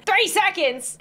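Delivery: Three seconds said high and alarmed. Real, not acted. Reacting to the quiz's tight time limit, alarmed. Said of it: "Oh God".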